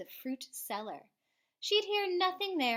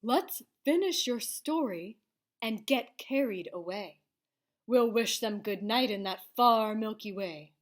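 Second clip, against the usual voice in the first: louder beast voice, excited; lightly voiced beast; 2 semitones lower, 5 dB louder than usual. Beast friend urging child onward in creature voice. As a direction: coax in a creature voice. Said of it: "his voice dropped".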